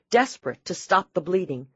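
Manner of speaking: usual speaking voice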